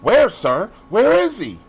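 high, loud, urgent questions; anxious shouted inquiry; shouting. A narrator's reading. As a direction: shout the question, rising in pitch. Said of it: "he shouted".

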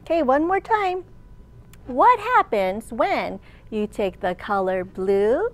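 usual speaking voice

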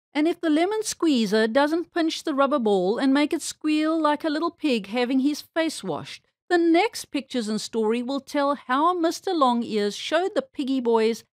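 plain reading voice

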